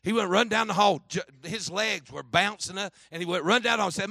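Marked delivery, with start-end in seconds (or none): none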